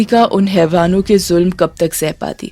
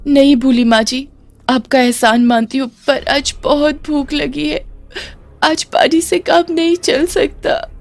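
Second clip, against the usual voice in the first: high, crying plea; acted plea breaking into crying; tearful pleading; 7 semitones higher than usual. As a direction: beg through rising tears. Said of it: "Latika फूट फूट कर रोने लगती है".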